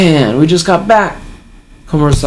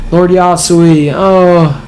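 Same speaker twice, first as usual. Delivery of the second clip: drawn-out, higher-pitched mock wail; mock yelling; 4 semitones higher, much slower than usual. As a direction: imitate a crowd crying out for its lord. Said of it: "People are yelling".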